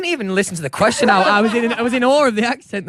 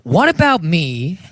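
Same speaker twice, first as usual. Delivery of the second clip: put-on slow, deliberate American accent; much slower than usual. Last question to his new friend in an American accent. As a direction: overplay the American accent, word by word. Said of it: "in an American accent".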